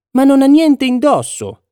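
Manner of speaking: put-on high, drawn-out little child's cry; child's sudden cry. A narrator's reading. Little child blurting out the truth about the emperor, high cry. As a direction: pipe up high and slow like a small child. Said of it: "gridò a un tratto un bambinetto".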